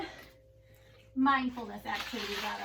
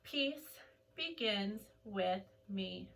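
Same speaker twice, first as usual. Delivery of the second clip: soft, very slow meditative chant; slow soft mantra; 5 semitones lower, 6 dB quieter, much slower than usual. Shown as a demonstration. Teacher leading kids in the mantra, slow and soft. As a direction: let each word float out. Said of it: "Take a deep breath".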